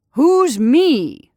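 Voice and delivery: loud puzzled shout. Acted. Santa shouts back to the unseen scarecrow, loud puzzled shout. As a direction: bellow it back across the dark. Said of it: "shouted Santa Claus".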